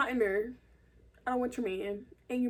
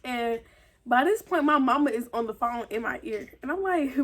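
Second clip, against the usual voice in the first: ordinary talk, nothing marked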